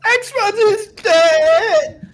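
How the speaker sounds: very high, drawn-out squeal; strained high wail, stretched right out; put-on troll voice. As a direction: screech it slowly like a mock troll. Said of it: "how that voice you made".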